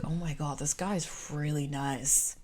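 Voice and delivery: soft, low-volume amazed aside; hushed disbelief. Retold or imitated. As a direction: say it under your breath, slowing down in wonder. Retold awed reaction to stranger's kindness.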